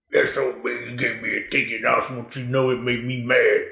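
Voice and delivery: a low, soft hint of a grumbling old man. To the retired cop, an old man complains, low and soft mock grumble. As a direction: drop low and quiet like the old smoker. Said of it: "Is that how he sounded?".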